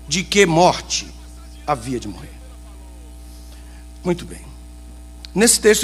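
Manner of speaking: ordinary talk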